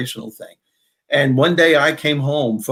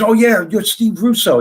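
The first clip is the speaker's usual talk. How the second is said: higher-pitched imitation of a delighted fan; excited recognition; 9 semitones higher, 4 dB louder than usual. Seatmate recognizing a comedian on a flight, excited. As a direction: retell it as a sudden, pleased recognition.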